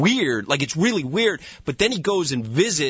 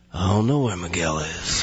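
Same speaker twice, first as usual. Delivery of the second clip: low, lazy deadpan cop answer; reply in character, gravely self-important; Steven Seagal impression; 7 semitones lower than usual. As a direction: pitch dropped, words loose and unsmiling. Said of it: "I became Steven Seagal".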